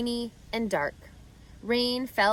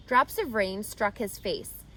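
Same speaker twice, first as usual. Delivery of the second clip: ordinary delivery, nothing marked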